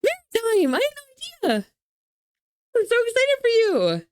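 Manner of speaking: high, loud delighted gush; gasp, then squeal with joy; excited. Real, not acted. Gasps at friend's news.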